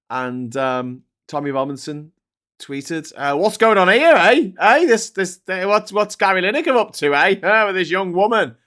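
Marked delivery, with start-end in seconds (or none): 3.4-8.6 higher and louder sneering busybody; mocking insinuation. Host mimics Tommy Robinson's tweet, higher, louder and sneering. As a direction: do him as a leering gossip. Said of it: "And he's like".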